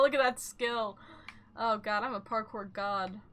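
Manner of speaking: usual speaking voice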